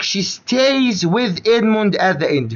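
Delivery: very slow, word by word; slow dictation. Shown as a demonstration. Teacher reading the English phrase slowly to Omar. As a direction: draw each word out for the student.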